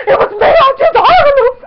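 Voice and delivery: high, screechy excited shout; very loud, high fangirl outburst; freaking out. Retold or imitated. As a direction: yell it as a frantic fan. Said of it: "I was, like, freaking out".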